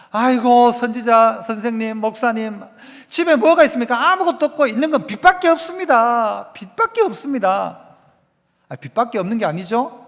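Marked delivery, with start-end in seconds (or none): 0.1-7.8 plaintive wail, pitched up in the middle; pleading widow. Acted. Poor widow telling the prophet she has only debt, plaintive acted lament. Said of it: "이 과부가 이 하는 이야기".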